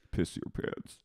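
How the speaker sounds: whispered sinister order; hushed, voiceless mock menace; Katie Maloney. Katie commanding him where he stands, whispered menace. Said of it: "she goes".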